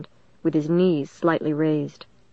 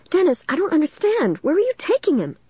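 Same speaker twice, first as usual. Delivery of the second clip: high-pitched, bewildered questioning; totally confused; 11 semitones higher than usual. A narrator's reading. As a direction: ask in high, confused alarm. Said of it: "totally confused".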